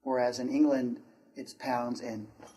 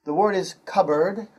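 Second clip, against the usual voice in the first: ordinary talk, nothing marked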